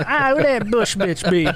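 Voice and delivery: put-on slow, slurred half-drunk drawl; drunk homeless guy. Radio host mimicking a drunk caller, slow slurred drawl. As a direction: mimic a drunk homeless man heckling on air. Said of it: "He was talking like that".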